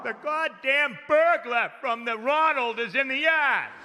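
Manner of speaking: high, slow worked-up dad; agitated dad. Retold or imitated. Dad telling mom about the yard, high and slow. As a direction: rant like a flustered father.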